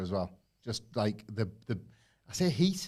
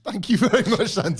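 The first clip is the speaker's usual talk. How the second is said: high, loud Italian-style Santino voice; hammed-up Santino Marella thanks; Santino Marella impression; 8 semitones higher, 9 dB louder, faster than usual. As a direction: ham up the wrestler's thank-you. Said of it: "it's me doing Wilborn doing Santino Marella".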